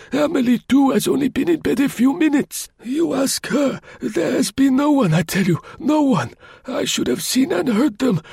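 put-on high, plaintive old voice, insisting; old concierge protesting pitifully; pathetic old man. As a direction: plead your innocence like a frail old man. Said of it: "he reiterated pathetically".

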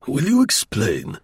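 slow, mock-bold demand; show of bluster. A narrator's reading. Gourdon confronting his colleague with bluster. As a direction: put on a blustering front. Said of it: "with a show of bluster".

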